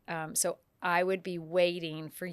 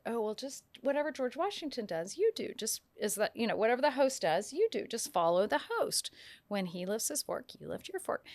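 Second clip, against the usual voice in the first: breezy instruction; 4 semitones higher than usual. Retold or imitated. Voicing consultant advising the dinner guests.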